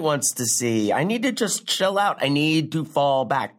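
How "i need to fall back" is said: normal talking voice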